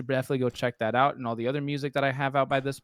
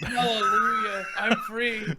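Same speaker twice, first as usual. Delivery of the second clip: mock joyful shout; 6 semitones higher, slower than usual. Guest freed, mock celebration.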